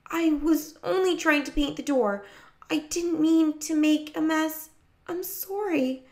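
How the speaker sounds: high, wavering apology; anxious child owning up; wobbly. A narrator's reading. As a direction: plead nervously in a small high voice. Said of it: "Her voice sounded as wobbly as her knees felt".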